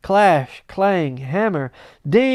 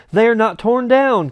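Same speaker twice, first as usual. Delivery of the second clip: loud, raised cry of relief; joyful cry; 6 semitones higher, 6 dB louder than usual. Acted. Scrooge finding his bed curtains, crying out. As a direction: cry it out in delight. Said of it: "cried Scrooge".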